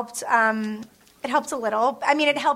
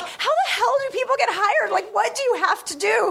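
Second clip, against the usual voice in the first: exasperated rant; 10 semitones higher than usual. Retold or imitated. Retold exasperation over hiring.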